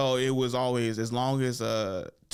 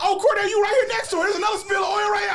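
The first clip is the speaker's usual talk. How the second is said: very high, loud, fast gleeful roast; roasting shout; 9 dB louder, faster than usual. Retold or imitated. Cousin turning the roast on Cornell, fast loud shout. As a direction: fire off the roast yelling.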